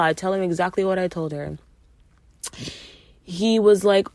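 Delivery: ordinary talk, nothing marked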